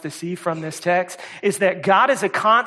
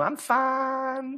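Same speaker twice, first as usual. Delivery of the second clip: high, Southern-drawled FINE, closer to "fahn"; playful Southern-accent imitation; 8 semitones higher, 4 dB quieter than usual. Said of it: "said it with the Southern accent".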